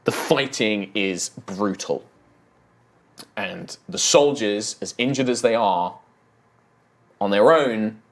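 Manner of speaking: dramatic scene setting. Acted. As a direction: narrate the battle with force and weight. Game master's dramatic scene setting.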